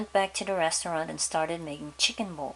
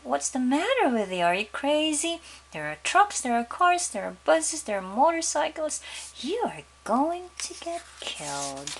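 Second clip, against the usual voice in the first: scolding father; 6 semitones higher than usual. Acted. Father scolds daughter again.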